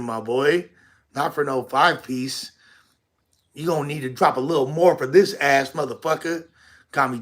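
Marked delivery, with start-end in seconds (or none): none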